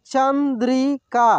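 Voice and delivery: Chandrika drawn out slowly, syllable by syllable; slow sounding out. Shown as a demonstration. Teacher slowly sounds out Chandrika for students. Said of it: "एक एक मात्रा को अलग अलग करके".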